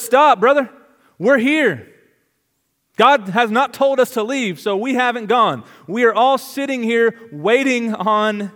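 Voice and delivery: high, loud plea to stop; urgent call. Acted. Paul calling out so the jailer will not kill himself. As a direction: shout him back from the edge.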